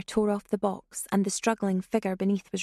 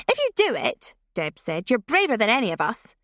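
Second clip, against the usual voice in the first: teasing dare; 3 semitones higher, 5 dB louder than usual. Acted. Teasing dare among girls.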